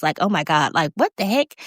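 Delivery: usual speaking voice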